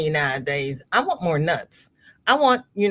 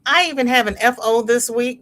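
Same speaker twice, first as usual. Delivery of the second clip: ordinary talk, nothing marked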